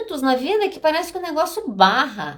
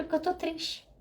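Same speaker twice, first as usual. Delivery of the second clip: tearful answer; 5 semitones higher, 10 dB quieter than usual. Retold or imitated. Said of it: "Aí eu chorei".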